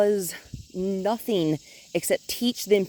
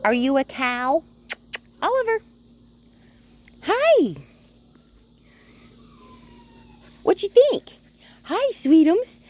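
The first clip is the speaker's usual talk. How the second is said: much higher sing-song cooing; 13 semitones higher, 5 dB louder than usual. Real, not acted. Trainer greets the dog Oliver in high sing-song baby talk.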